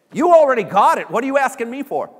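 loud, high imagined reply; big, high-pitched exclamation; exclaimed reassurance. Acted. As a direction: burst out as if it is obvious.

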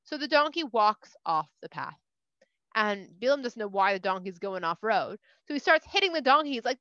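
ordinary delivery

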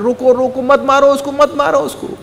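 high-pitched urgent plea to stop. Retold or imitated. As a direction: plead high and pressing, as if halting a beating. Guru stops crowd beating his attacker.

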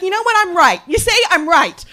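high, loud gloating cry; vindicated against her praying mother-in-law, high and loud; triumphant. Retold or imitated. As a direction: crow about being proven right. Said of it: "I was like".

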